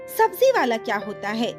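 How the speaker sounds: ordinary delivery, nothing marked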